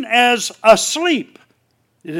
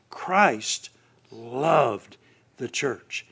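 "Christ loved the church" sunk lower and much softer, each word apart; emphatic phrase; 8 semitones lower, 8 dB quieter, much slower than usual. Shown as a demonstration. Preacher putting full emphasis on the phrase, hushed and low. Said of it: "no way that you can put sufficient emphasis on that phrase".

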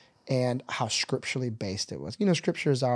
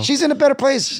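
much higher and louder, a sarcastic parrot; mocking quote; 12 semitones higher, 9 dB louder than usual. Mocking what people tell grieving families, sarcastic. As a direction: mimic the hollow cliché with scorn.

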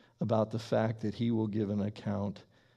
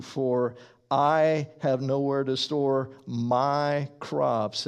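louder, slow, pressing on I and my; stressing the pronouns; 4 dB louder, slower than usual. Acted. Preacher voicing the rich man, pronouns stressed. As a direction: lean on every I, me and my. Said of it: "I'll try to emphasize them a little bit".

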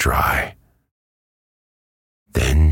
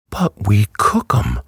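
triumphant, higher-pitched retort; 8 semitones higher than usual. A narrator's reading. Dorothy defends eating animals to the hen, triumphant. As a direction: score the point with pride. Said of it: "said Dorothy triumphantly".

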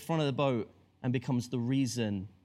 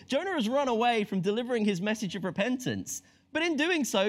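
ordinary delivery

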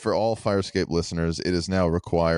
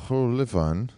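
Rullevan higher with a bouncy rising lilt; mock Norwegian accent; 5 semitones higher, slower than usual. Shown as a demonstration. Friend copying the host, light mock Norwegian accent. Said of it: "a very bouncy sounding language".

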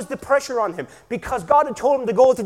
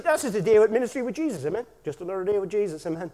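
ordinary talk

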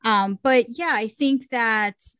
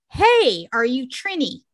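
retold call-out, higher and louder; 3 semitones higher, 5 dB louder than usual. Friend asking people in the next car, calling out. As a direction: call over to strangers, louder and higher on "Hey".

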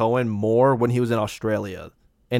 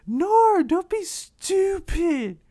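much higher and slow, imitating his girlfriend; high mock girlfriend; much slower than usual. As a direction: mimic her in a squeaky high voice. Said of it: "She's like".

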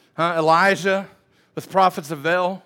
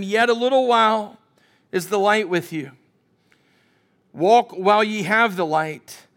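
raised, solemn King James reading; scripture reading; 3 semitones higher, 4 dB louder than usual. Acted. Preacher reading scripture to the congregation, raised and solemn. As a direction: proclaim the old text aloud.

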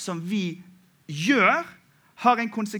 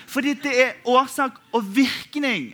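laughing; 6 semitones higher, 5 dB louder than usual. Real, not acted. Laughing punchline of sermon point.

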